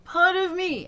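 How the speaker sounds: the same words spoken high but pushed, the take to avoid; wrong way. Shown as a demonstration. Coach showing the wrong pushed version. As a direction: push it the wrong way. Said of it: "Remember, it's not this".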